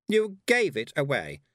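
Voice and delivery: slow, high, loud accusation; cutting reproach; sharp voice. A narrator's reading. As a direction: accuse her sharply from behind. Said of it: "said a sharp voice behind her".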